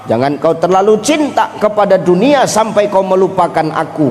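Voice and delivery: ordinary talk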